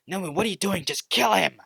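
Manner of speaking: angry shouting. Acted. Shigaraki shouts orders at Nomu.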